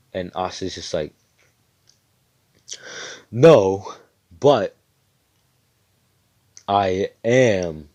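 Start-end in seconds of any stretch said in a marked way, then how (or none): 3.3-7.9 a sudden loud "No, but"; defiant. Retold or imitated. Asta cutting off the examiner, loud and defiant.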